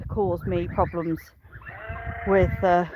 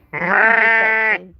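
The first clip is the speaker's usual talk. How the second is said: slow, louder sing-song hello; drawn-out greeting; 7 dB louder, much slower than usual. Real, not acted. Farmer greets her lamb Petsy, slow drawn-out call. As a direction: stretch the name out to call the lamb.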